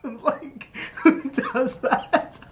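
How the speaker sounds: real giggly laughter through the words; laughing too hard to talk. Real, not acted. Vlogger cracking up at the show's quips, laughing.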